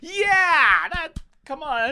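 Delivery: very high, loud mock cheer; imitating the crowd's supportive shout. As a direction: yell it like a crowd egging you on.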